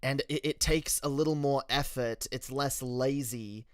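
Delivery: light British accent, deliberately enunciated. Shown as a demonstration. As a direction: think about every word, British accent held. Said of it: "to keep this accent up".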